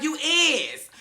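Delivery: high, loud mock correction; sassy retort. D'Angelo correcting Ryan's grammar, high sassy jab. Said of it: "He was like".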